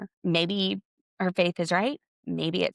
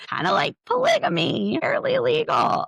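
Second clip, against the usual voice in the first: very high, louder teasing impression; imitating Tina's joke; 5 dB louder than usual. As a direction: toss off the joke in a squeaky high voice. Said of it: "Tina goes".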